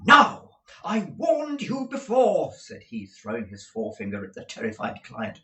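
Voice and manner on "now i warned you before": higher, louder, slow scolding; irate. A narrator's reading. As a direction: scold him hard. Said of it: "suddenly became most irate".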